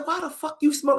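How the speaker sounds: high-pitched dad demanding answers; imitated angry interrogation. Imitating dad questioning son about missing school, high and irate. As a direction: grill the son, voice pushed up high.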